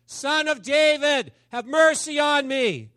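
high, loud, long-held cry; insistent pleading shout, words stretched out. Acted. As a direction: yell it out to be heard over the crowd. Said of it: "he yelled louder".

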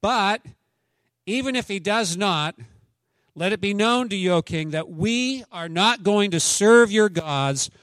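high, loud, defiant reading; preacher reading Daniel's defiance aloud; scripture reading. Acted. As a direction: declaim it like the three men before the king. Reads scripture with force.

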